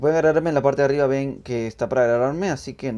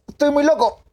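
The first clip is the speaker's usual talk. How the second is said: manic high shout; berserk rage; 13 semitones higher, 7 dB louder than usual. Real, not acted. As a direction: yell it like a crazed madman. Running over enemies in game.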